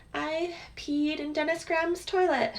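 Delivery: high, mock-proud little announcement; put-on high voice sharing a silly fact; fun-fact boast. Quotes own fun fact.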